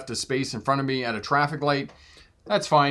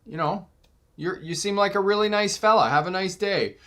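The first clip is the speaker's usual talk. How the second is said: higher, innocent-sounding sweetness; mock innocence; 7 semitones higher than usual. Truck driver to the angry driver, feigned innocence. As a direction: play dumb, all innocent niceness. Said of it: "I'm like".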